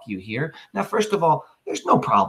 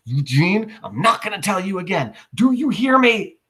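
mother calling, rising high on the last question; mother calling her son, climbing to a high demand; 9 semitones higher than usual. Acted. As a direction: call him in, a warning in it. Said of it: "And she calls out".

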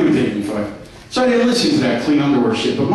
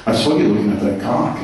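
lower, accusing wife voice; lightly voiced wife, lower and reproachful; mock accusing wife; 8 semitones lower than usual. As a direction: play the suspicious wife catching him.

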